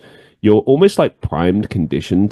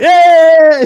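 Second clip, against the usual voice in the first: sarcastic cheer, much higher and louder; mock cheer; 7 dB louder than usual. Crowd mocks the comedian with a loud fake cheer. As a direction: throw out a big fake yay. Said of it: "clap and cheer".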